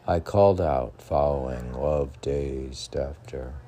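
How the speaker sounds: slow, hushed poem recital sinking low; poem reading. Acted. Reads translated poem aloud.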